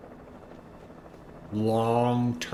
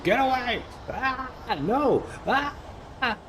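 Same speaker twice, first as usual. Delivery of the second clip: much higher, squealing hammed-up panic; mock panic. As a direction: squeal it like a scared kid being chased. Panicking as enemies close in.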